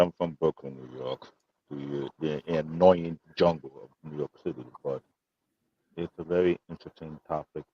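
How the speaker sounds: muffled, far from mic. Real, not acted. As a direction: voice buried and faint, far from the microphone. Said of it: "you sound muffled".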